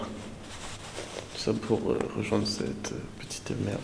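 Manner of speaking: hushed whisper, slow. Real, not acted. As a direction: whisper so he won't wake up. Whispers insult about sleeping friend.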